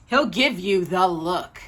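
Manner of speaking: ordinary delivery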